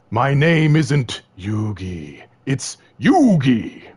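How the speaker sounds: slow, drawn-out, pompous correction; mock Yugi voice. Yugi correcting Pegasus, slow and pompous. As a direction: drag every word out like an offended hero. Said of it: "And then Yugi was like".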